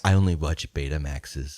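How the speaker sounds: deadpan eighties hipster, unimpressed; bored ironic hipster. Pretend. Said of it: "That's my hipster voice from the eighties".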